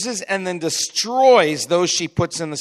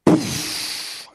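breathy, explosive whoosh; burst of released energy; 7 semitones higher, 4 dB quieter than usual. Retold or imitated. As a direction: imitate pent-up energy bursting out in air. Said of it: "so much that it's like".

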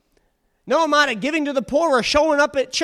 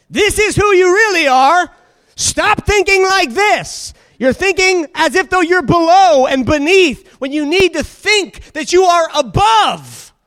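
preaching at full force; 4 semitones higher, 7 dB louder than usual. Acted. Preaching climax.

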